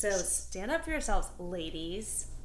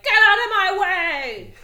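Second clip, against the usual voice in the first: slow, loud, higher angry yell; 10 semitones higher, 14 dB louder, slower than usual. Pretend. Shopper yelling at a line-cutter, loud and slow. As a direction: bark it at someone in the way. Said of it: "if you're just like".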